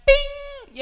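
high, ringing "bing"; high-pitched electronic ping imitation; imitated GPS ping. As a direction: sing out a GPS alert chime. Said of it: "you hear that".

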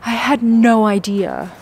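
normal talking voice

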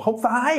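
"phải" stretched out and pulled high; intense rejection. Shown as a demonstration. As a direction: pull "không phải" way up and draw out the ending. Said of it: "pull it high, and add that rising ending".

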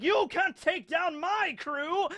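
slow, high-pitched boast to the enemy; defiant pirate captain. Acted. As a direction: taunt them, drawing out each word. Rox defies Garp and Roger.

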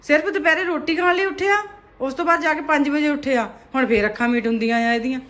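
loud exasperated scolding; dramatic loud aunty. Real, not acted. Mother loudly scolding sleepy son.

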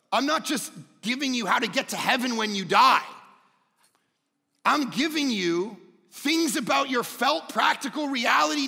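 loud, high, impassioned sermon voice; full-force preaching. Real, not acted. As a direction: proclaim it to the whole congregation.

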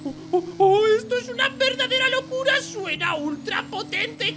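high, loud excited marveling; thrilled amazement. Acted. Driver testing his new supercar, thrilled. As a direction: whoop over the roaring engine. First test of powerful car.

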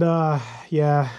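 ordinary delivery

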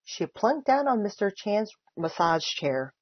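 ordinary delivery

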